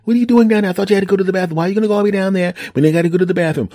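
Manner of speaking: high, insistent mock wife voice; imitated incredulous wife. Voices wife calling down to cellar.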